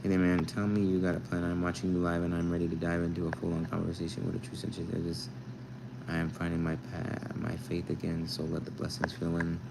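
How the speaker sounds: quiet, low rhythmic reading; soft, level spoken-word cadence; like poetry. Real, not acted. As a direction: read it softly in even verse. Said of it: "Why does that sound like poetry?".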